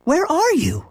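very high, puzzled question; surprised mother. A narrator's reading. Mother asking her son on the phone, surprised. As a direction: ask it high, taken aback. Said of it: "His mother sounded surprised".

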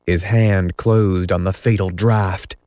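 usual speaking voice

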